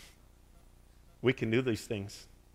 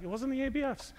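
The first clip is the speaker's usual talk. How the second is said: higher, dismissive reply; voicing the owner; 12 semitones higher, 5 dB quieter than usual. Owner telling pastor it wasn't the classes, lightly voiced. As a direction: brush it off lightly.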